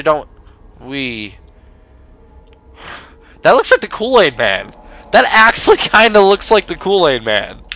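ordinary talk

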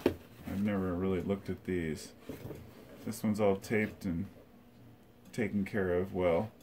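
normal talking voice

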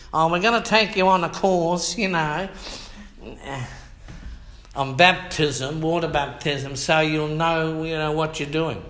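sarcastic imitation; mocking church voice. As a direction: send up the pushy pastor. Mocking church course offer.

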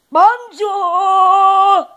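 put-on loud, high, stretched-out French accent. Kid finally finds a French hello, loud French accent. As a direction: belt it out as an exaggerated French greeting. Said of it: "lots of all French".

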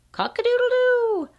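high crowing rooster call, falling at the end. Pretend. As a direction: crow like a rooster waking the barn. Reading barn book to child.

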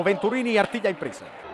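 ordinary talk, nothing marked

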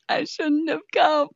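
theatrical cry of regret; mock despair. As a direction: wail in fake despair. Mock despair, losing control.

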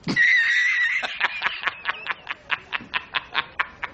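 disbelieving laughter; laughing fit. Real, not acted. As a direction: burst out laughing at the result. Laughs at the box result.

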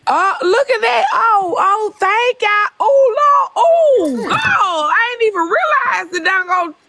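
high, overwhelmed surprise at a gift; exclaim, real and choked up with gratitude; emotional. Real, not acted. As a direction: pitch leaps up in stunned thanks. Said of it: "Now y'all making me emotional".

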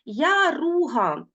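Яруга stretched as я-ру-га; slow syllables. Shown as a demonstration. Teacher repeats Яруга for pupils in syllables. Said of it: "теж голосні склади".

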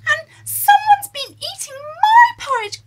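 very high, loud tearful cub wail; crying Baby Bear. Acted. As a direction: wail like a little bear whose breakfast is gone. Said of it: "Baby Bear said".

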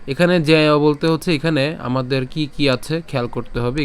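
ordinary delivery

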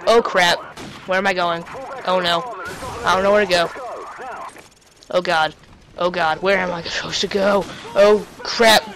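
low, soft worried muttering; mild panic. Real, not acted. Lost under fire, muttering to himself in mild panic. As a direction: mutter like you're lost and scared.

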